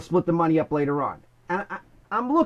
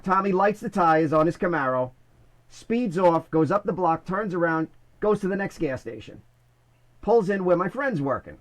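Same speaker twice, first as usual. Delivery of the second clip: normal talking voice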